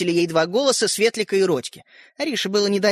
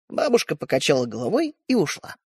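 usual speaking voice

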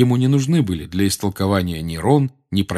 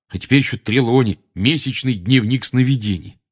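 louder, higher complaining; loud grumbling; 5 semitones higher, 5 dB louder than usual. A narrator's reading. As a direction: grumble loudly about homework. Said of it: "Рон при этом громко ворчал".

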